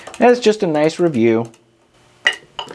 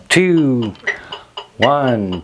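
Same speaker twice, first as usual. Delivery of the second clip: drawn-out beats stepping down in pitch; rhythmic count-in; 5 semitones lower, much slower than usual. Shown as a demonstration. Drum teacher counting students in for the test, rhythmic count-in. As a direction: count them in on the beat, slowing into the start.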